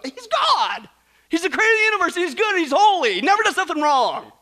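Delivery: high, loud, rousing declaration; emphatic indignation. Real, not acted. Preacher rebuts those who find trust hard, indignant. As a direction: proclaim it forcefully.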